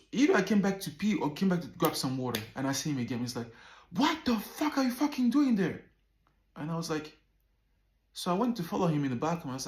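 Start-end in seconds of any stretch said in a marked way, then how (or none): 3.9-5.8 high-pitched, louder angry confrontation; mad outburst. Retold or imitated. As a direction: imitate an angry friend yelling. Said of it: "get so mad about any movement".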